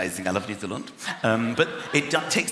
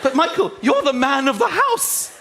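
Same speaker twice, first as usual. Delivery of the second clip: higher, louder pleading wife; insistent wife; 9 semitones higher, 5 dB louder than usual. Retold or imitated. Wife urging husband downstairs, insistent pleading.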